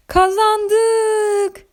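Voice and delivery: çok tiz, uzatılmış sevinç çığlığı; neşeyle, incecik sesle haykır; neşeli bağırış. A narrator's reading. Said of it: "diye bağırdı Zambazilla neşeyle". (Zambazilla'nın neşeli zafer bağırışı)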